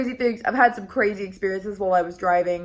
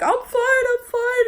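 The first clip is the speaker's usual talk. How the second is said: high crying voice repeating she's fine; retold sobbing; 4 dB louder than usual. Reassuring the other driver, high tearful sobbing. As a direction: blubber it out, pitched high. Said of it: "sobbing so hard".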